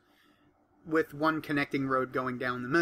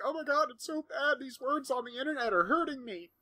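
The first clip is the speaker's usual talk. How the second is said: high-pitched mock complainer; mock an oversensitive whiner, pitched way up; whiny mockery; 10 semitones higher than usual. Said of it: "those people who are like".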